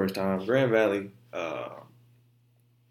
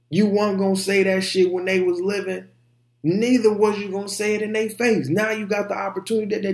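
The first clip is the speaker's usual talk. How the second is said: heated rant; 8 semitones higher, 4 dB louder than usual. Real, not acted. Angry rant at disrespecters.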